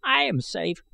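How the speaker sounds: put-on high, thin old man's retort. A narrator's reading. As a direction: snap back like a wry old man. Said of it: "retorted I Gos".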